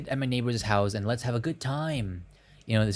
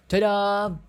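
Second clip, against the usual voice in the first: high excited reveal; 10 semitones higher, 6 dB louder than usual. Acted. Blindfold reveal.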